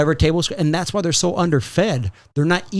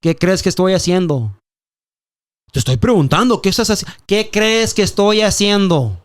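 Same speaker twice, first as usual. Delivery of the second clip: loud defiant comeback in Spanish; doubling down shout; retort; 4 semitones higher, 5 dB louder than usual. Retold or imitated. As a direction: talk back louder. Retorting to neighbor.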